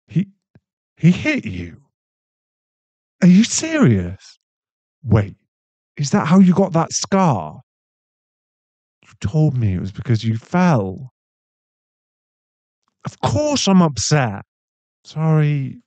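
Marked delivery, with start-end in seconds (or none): 3.2-14.4 raised, higher voice in angry disbelief; shocked outrage. Acted. Boyfriend learning she was hit, shouting in outrage. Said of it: "I don't mean to shout". 15.0-15.8 quick raised sorry, higher and rising; still-heated apology. Acted. Boyfriend apologising to girlfriend for shouting, still heated. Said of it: "I don't mean to shout".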